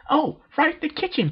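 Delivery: very high, bubbly cartoon pony; high and loud, childlike insistence; Pinkie Pie voice. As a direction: squeak it out like a hyper party pony.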